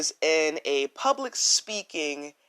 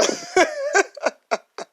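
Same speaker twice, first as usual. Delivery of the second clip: bright burst of laughter; high laugh; 5 dB louder than usual. Real, not acted. Host laughs at her grandmother story, real laugh. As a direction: laugh at the memory.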